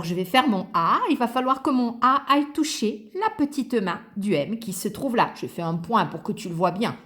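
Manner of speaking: normal talking voice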